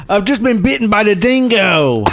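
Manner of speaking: high, hammed-up Aussie alarm; Australian accent. Bitten by a dingo, high mock Australian accent. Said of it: "our Aussie listeners are like".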